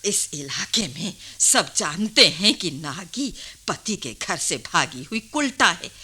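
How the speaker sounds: ordinary talk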